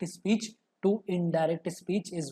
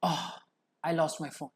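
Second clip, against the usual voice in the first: sadness; 3 semitones lower, 5 dB quieter than usual. Shown as a demonstration. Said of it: "a feeling or emotion of sadness".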